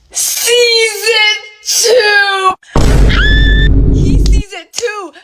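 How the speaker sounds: very slow, high drawn-out wail; horrified. Retold or imitated. Storyteller at the climax, he saw it too, horrified wail. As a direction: stretch every word into a high cry of dread.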